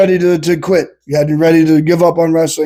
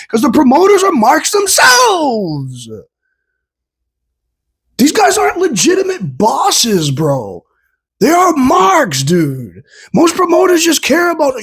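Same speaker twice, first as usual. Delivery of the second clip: cracking high yell at the promoters; fed-up vlogger shouting, near tears; exasperated rant; 9 semitones higher than usual. Real, not acted. Ranting about promoters.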